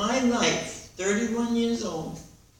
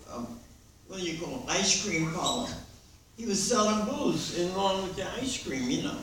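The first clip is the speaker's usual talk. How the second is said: ordinary talk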